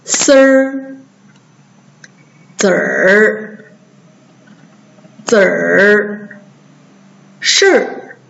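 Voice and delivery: 丝儿, 子儿, 事儿 singly, i replaced by "er"; erhua demo. Shown as a demonstration. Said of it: "跟着老师读".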